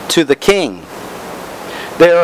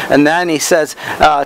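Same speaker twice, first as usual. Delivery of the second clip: ordinary delivery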